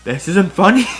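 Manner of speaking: trying to sound stern but cracking up; laughing through it. Acted. Captive Jedi protests.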